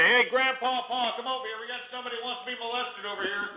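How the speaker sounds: high, soft call as if away from the mic; calling someone over. Pretend. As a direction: pretend to summon someone from the next room. Calling Grandpa Paul over.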